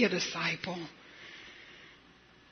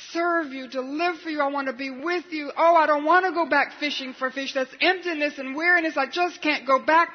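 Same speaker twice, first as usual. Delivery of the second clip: higher and louder, fervent begging; pleading prayer; 5 semitones higher, 5 dB louder than usual. Acted. Peter swimming back, begging Jesus to take him back, fervent pleading. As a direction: pour it out like a desperate plea.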